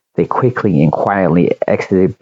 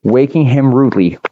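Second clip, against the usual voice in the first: ordinary delivery, nothing marked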